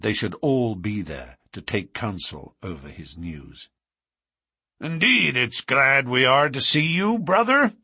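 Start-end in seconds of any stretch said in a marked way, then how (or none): 4.8-7.7 high, loud welcome in a character voice; hearty chairman's cry. A narrator's reading. Chairman welcomes a brother to the lodge, crying out high. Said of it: "cried the chairman".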